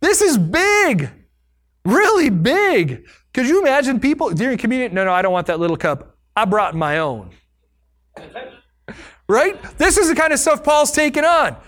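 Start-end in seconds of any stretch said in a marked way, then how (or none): 4.9-7.3 snooty churchgoer. Retold or imitated. Mocking picky communion-goer.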